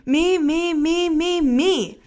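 put-on high clamouring chant of kids; a whole class begging at once; shouted. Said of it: "everyone shouted at once".

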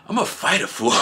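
laughing mock tough talk.

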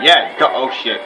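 ordinary talk

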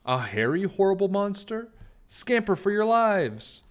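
a tiny mouse shrieking everyone to run; panicked warning. Acted.

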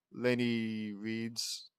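plain reading voice

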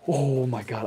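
loud, long-drawn amazement; overwhelmed cry. Real, not acted. Streamer cannot believe the card in hand, loud amazement. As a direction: belt it out in awe.

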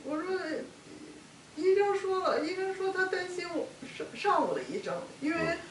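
high, loud, upset voice; overwrought, voice lifted high and loud; real distress. Real, not acted. Upset recalling doctors, asked to pause.

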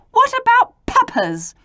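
high, loud pouting plea; pleading. A narrator's reading. Pouting P speaking up for papas, pleading. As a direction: plead peevishly, pitched right up. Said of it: "he pleaded".

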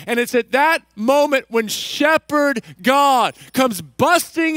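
high, loud, driving sermon delivery; impassioned preaching. Real, not acted. Preacher assuring hurting congregation that God is coming, fervent and loud. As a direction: preach it with full force, urging hope.

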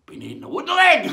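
high, very loud pirate bellow; shouted punchline. Acted. Third pirate tops his mates' laughter, triumphant shout. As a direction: belt out the joke's payoff.

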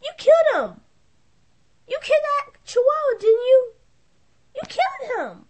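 high, loud, tearful cry of accusation; anguished accusation. Acted. Owner accusing the players of killing her dog, sobbing. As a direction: wail it at them through tears.

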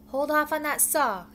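loud, high-pitched shout; yell. A narrator's reading. Dave halting the crew as the saw nears, yelling. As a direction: yell out an order to stop. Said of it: "Dave yelled".

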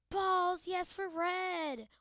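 loud high shout, then stretched out slow; excited yell. Real, not acted. Gamer yelling at his red ball. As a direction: burst out with glee at being red.

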